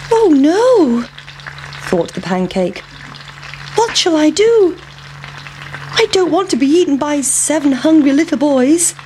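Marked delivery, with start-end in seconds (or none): 0.1-1.1 high, loud cry of dismay; a drawn-out, high-pitched yelp of worry; alarmed pancake. Acted. Pancake realising it will be eaten, alarmed. 3.8-8.9 high-pitched, worried pancake voice; fretting pancake. Acted. Pancake afraid of seven hungry boys, fretting.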